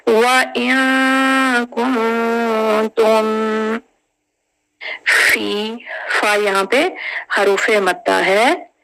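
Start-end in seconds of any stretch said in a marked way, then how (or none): 0.1-3.3 व इन कुंतुम drawn out, slow; slow tajweed chant; Quranic recitation. Shown as a demonstration. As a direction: recite व इन कुंतुम with held vowels. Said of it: "मीम को सुकून से".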